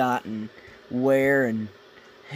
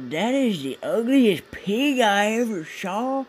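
slow, higher mock voice for the rude neighbour; porch guy voice; 8 semitones higher, 3 dB louder, slower than usual. Telling a dad joke, voicing the rude porch guy in a hammed-up voice. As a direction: ham up the man on the porch insulting the dog. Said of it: "and he goes".

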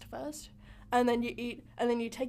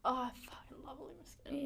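hushed, breathless whisper; 12 dB quieter than usual. Real, not acted. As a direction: whisper it like a secret. Whispered joke food love.

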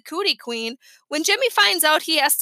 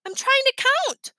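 put-on annoyed little boy; 11 semitones higher than usual. A narrator's reading. Fudge annoyed at interruption.

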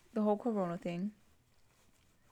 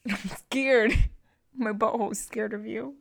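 laughing mock fear; 2 semitones higher, 5 dB louder than usual. Real, not acted. Teased about wedgies, laughing mock fright.

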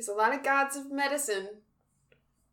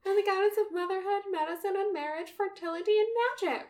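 high, bright welcoming goddess; sweet goddess voice; 11 semitones higher, faster than usual. Retold or imitated. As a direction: greet everyone like a cheery deity. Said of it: "Isis is there just being like".

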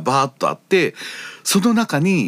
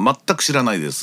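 normal talking voice

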